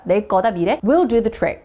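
usual speaking voice

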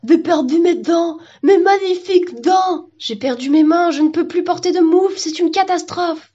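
high, drawn-out lament; hammed-up high-pitched despair; distraught witness. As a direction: wail over a dreadful loss.

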